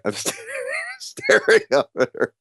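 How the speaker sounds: breaking up laughing mid-word; high, squeaky laugh through the words; too amused to get the sentence out; real laughter. Real, not acted. Laughs at own embarrassing story.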